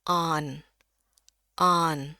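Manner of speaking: "on" avec un a ouvert tirant vers o, puis n; voyelle épaisse allongée. Shown as a demonstration. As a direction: tenir "on" longuement, mâchoire basse. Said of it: "bunyinya sedikit lebih panjang".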